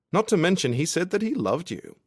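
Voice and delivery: high, unhelpful interjection; Ryde chipping in. A narrator's reading. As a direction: chime in with a high character voice. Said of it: "Ryde chips in unhelpfully".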